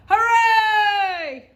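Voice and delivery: high, loud, far-off cheer; echo shouting back. A narrator's reading. The echo cheering back to the friends, loud and distant. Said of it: "the echo shouted back".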